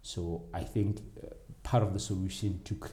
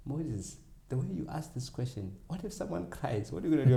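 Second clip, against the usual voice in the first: put-on curious kid asking; 7 semitones higher than usual. Kid asks Moses what he'll do if someone cries, higher and rising. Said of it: "one of the kids once asked me".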